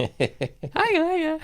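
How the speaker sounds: very high put-on child greeting; little Chernobyl kid. Chernobyl kid greeting the audience, high silly child voice. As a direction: pipe up in a tiny, squeaky kid voice. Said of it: "This little kid from Chernobyl".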